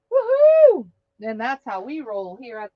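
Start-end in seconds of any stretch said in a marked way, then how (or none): 0.1-0.9 quick high whoop in her own voice; own cheer. Real, not acted. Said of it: "as [name] says".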